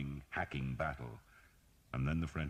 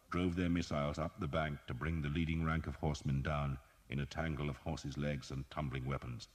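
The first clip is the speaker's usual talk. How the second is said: ordinary delivery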